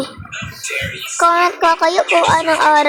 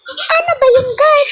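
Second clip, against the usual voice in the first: laughing; 11 semitones higher than usual. Real, not acted. Laughing at falling in game.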